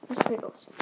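breathy hushed whisper. Pretend. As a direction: drop to a secret whisper. Repeats name hushed.

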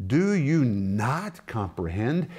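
slow, drawn-out question leaning hard on "not"; emphatic NOT. Shown as a demonstration. Preacher paraphrases Paul to the Corinthians, emphatic slow "not". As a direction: stretch and stress "not", rising at the end. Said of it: "is the emphatic form of the word".

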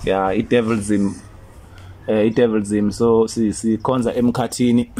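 normal talking voice

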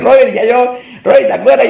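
high, loud put-on voice; mimicked callers. Former clients ringing the pastor back, high mocking imitation. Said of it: "Me dicen".

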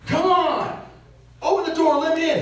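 high, loud demanding yell at his wife; angry yelling. Retold or imitated. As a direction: bark it at her through the car door. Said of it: "and he's yelling at her".

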